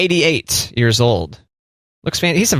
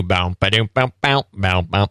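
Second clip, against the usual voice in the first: bouncy mouth-noise bass riff; mimicked bass line; 3 semitones lower than usual. Shown as a demonstration. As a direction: sing the slap bass with your mouth. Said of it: "that iconic bass line".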